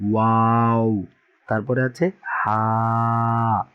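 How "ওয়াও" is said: "ওয়াও" drawn out through rounded lips. Shown as a demonstration. Teacher shows students the mouth shape, long rounded "ওয়াও". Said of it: "মুখটা এরকম গোল হয়ে যাবে".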